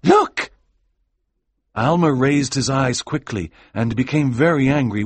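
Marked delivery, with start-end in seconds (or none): 0.0-0.5 assistant's excited call, jumping very high; urgent alert. A narrator's reading. Assistant warning his master, excited and rising high. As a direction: call out, rising sharply to the last word.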